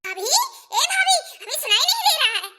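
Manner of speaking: high, hurried shouts to wake someone; urgent yelling. Acted. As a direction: yell impatiently at a sleeper. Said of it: "इतना तेज तेज क्यों चीख रही है". (ज़ोर-ज़ोर से चीखकर जगाना)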